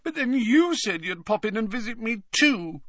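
put-on very high, wounded ghost-girl voice; high-pitched, plaintive accusation; reproachful ghost girl. A narrator's reading. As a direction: reproach him in a high whine. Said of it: "gave Harry a reproachful look".

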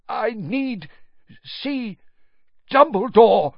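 a struggling madman's plea; great effort. A narrator's reading. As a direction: push each word out with effort. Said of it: "cost him a terrible effort".

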